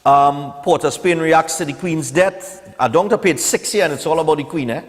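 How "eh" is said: ordinary talk, nothing marked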